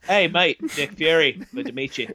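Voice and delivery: light Australian greeting as Fury; breezy Aussie mate intro; mock Australian. Cohost keeps the joke, light mock Australian. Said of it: "he should have been Australian".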